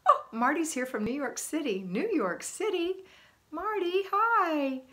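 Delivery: delighted shout-out to someone just arriving; excited greeting. Real, not acted. Host greets Marty joining from New York City, excited.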